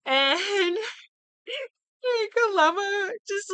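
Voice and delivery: words broken up by high laughter; can barely get it out for laughing; real laughter at the episode's ending. Real, not acted. Cracking up retelling the punchline.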